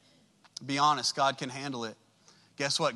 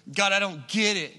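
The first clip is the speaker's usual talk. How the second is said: louder, exasperated cry to God; a heated, fed-up prayer; frustrated outburst; 5 semitones higher, 6 dB louder than usual. Retold or imitated. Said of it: "I'm frustrated and I'm mad".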